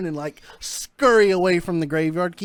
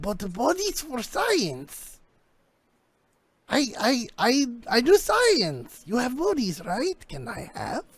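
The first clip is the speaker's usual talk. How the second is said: higher and slow, halting and pleading; odd scientist voice; 5 semitones higher, slower than usual. Acted. GM as the scientist pleading for bodies, high and slow. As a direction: stammer it like a strange eager scientist.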